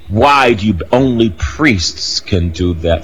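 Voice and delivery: normal talking voice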